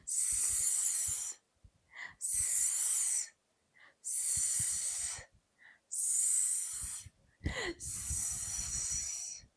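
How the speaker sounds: long, soft snake hiss; drawn-out s like a snake. Shown as a demonstration. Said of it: "can you sound like a snake with me".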